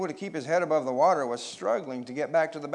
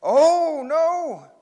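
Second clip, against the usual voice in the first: high, loud, drawn-out wail; sudden storybook shriek of alarm; alarmed cry; 13 semitones higher, 12 dB louder than usual. A narrator's reading. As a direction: cry out as you slip under. Larry suddenly cut off, going under.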